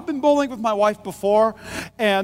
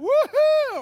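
high excited woohoo, much higher; whooping wife. Retold or imitated. Pastor imitating his wife cheering at the Nerf gun challenge, high whoop. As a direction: squeal it like a thrilled wife.

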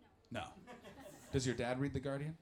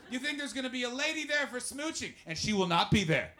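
normal talking voice

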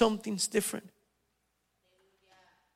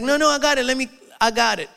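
higher and louder, hammed-up "I got it"; pushy mock insistence, pitched up and loud; 7 semitones higher, 6 dB louder, faster than usual. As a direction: play the eager relative brushing off help. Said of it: "He's like".

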